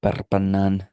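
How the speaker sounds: Perpignan with rolled r, mock French. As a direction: roll the r in Perpignan. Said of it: "I don't know why I'm rolling my R's".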